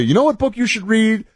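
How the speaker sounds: high-pitched mock helpfulness; eager recommender impression. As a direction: put on a high, pushy advice-giver voice. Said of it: "This is what people tell me".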